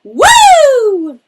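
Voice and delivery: very high, loud joyful woo; lottery woo. Shown as a demonstration. Just won the lottery, a high loud whoop. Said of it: "like we've all just won the lottery".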